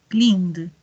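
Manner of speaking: "cleaned" with a gently released d; soft final D. Shown as a demonstration. Teacher drills past forms, "cleaned" with soft final D. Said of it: "terminar como una D más suave".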